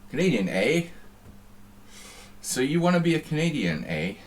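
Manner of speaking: mock Canadian accent. Streamer teasing a Canadian poker player, mock Canadian accent. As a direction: poke fun at the man in a jokey Canadian accent. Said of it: "He didn't talk like that".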